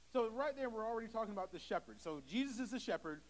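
usual speaking voice

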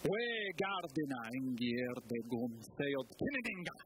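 low, slow bardic chant of "We gardena ingier de gum feod kinninga"; slow Old English verse, deep and measured; Old English recitation. Shown as a demonstration. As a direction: intone it like an ancient scop. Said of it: "That's Beowulf".

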